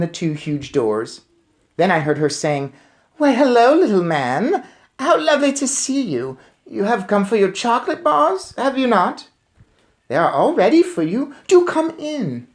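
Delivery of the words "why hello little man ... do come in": higher, sweet luring voice; fake-sweet welcome; sugary lady voice. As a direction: coax him in sweetly like a disguised witch. Witch sweetly lures the boy.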